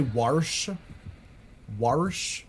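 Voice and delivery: "warsh" with an R before the sh; Baltimore pronunciation. Shown as a demonstration. Streamer showing Baltimore speech, "warsh" with an added R. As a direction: say "warsh" as war-sh, ending on a rise. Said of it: "with an R".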